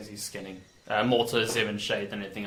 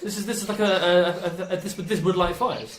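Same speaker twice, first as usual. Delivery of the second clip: much higher, wondering character voice; curious Roar voice; 6 semitones higher than usual. Acted. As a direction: peer at the toy in a high curious voice. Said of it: "It's like".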